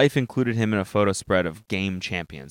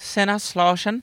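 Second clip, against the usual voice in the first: higher-pitched pretend German; mock German accent; 10 semitones higher than usual. Friend reading out a company name, mock German accent. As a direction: put on a playful German accent. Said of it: "Was that a good German accent?".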